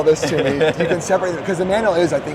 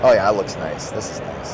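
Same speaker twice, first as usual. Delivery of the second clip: lost voice, rough and hoarse; scratchy hoarse voice; 7 semitones lower than usual. Real, not acted. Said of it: "I sound like I'm gargling rocks".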